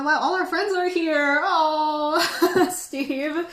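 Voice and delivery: light up as friends arrive; delighted greeting. Real, not acted. Host greeting returning chat friends, thrilled and loud.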